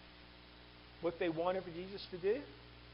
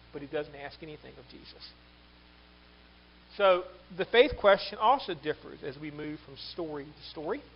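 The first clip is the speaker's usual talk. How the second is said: normal talking voice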